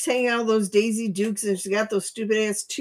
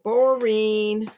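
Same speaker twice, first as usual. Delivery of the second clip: mock bored; 5 semitones higher, slower than usual. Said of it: "I said".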